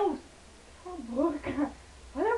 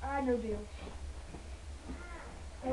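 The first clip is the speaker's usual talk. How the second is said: usual speaking voice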